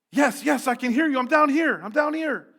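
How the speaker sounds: high, loud frantic shout; desperate yelling. A narrator's reading. Jack answers the voice from the cliff, frantic yell. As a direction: yell up that you're down here.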